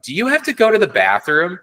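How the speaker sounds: put-on little kid at the beach earnestly asking a stranger; dead-serious kid. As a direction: ask it with total childlike seriousness. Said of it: "most serious face".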